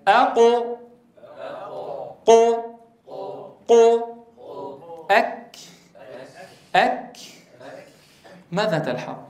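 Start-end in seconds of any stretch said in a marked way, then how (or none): 0.1-7.2 teacher modelling letters; letter sound demo. Shown as a demonstration. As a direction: model the isolated letters أقو and أك for students to repeat. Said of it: "قل معي".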